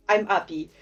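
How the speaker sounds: put-on light French accent, "I'm appy" with no H. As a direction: drop the H the French way, "I'm happy". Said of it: "Like, I just say".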